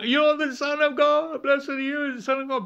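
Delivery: high, twisted mocking chant of a spirit. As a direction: call it out high in a twisted, off-putting voice. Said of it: "it's in a twisted voice".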